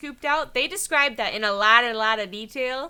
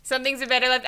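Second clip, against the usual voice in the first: ordinary talk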